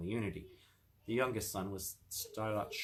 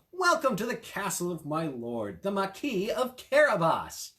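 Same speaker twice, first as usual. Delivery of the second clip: grand, higher welcome, slowing on the name; proud cat; 8 semitones higher, 7 dB louder than usual. A narrator's reading. Cat greeting the king grandly at the castle. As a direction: announce your master proudly to royalty.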